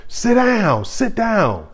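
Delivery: imitating a teacher's high yell; retold scream. Teacher yelling at a choking kid, high mock yell. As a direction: shout it up high, like an alarmed teacher. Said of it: "I remember my teacher screaming".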